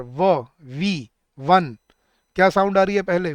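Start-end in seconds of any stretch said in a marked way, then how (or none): 0.0-1.8 very slow "व", "वी", then "वन", pitched down; isolated w sound. Shown as a demonstration. Teacher sounding out for students, slow separate "व" before "वन". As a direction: draw out "व" as wuh before "वन". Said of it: "वन बोल के देखो एक बार".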